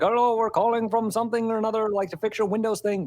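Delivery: cartoonish put-on Indian accent of a telemarketer; mock Indian accent. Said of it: "Please don't do the accent".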